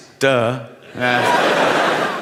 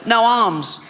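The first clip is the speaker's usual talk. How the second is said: higher put-on child's voice; kid voice punchline; 7 semitones higher than usual. Comedian voicing the son's blunt punchline in a higher kid voice.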